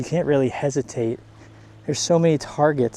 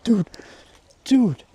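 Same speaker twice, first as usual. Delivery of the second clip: high amazed exclamations; awed by the swarm; excited; 5 semitones higher, slower than usual. Real, not acted.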